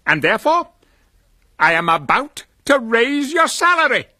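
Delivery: spring the good news on him; joyful reveal. Acted. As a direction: Scrooge surprising his clerk with a raise, high and loud. Scrooge springs a joyful surprise.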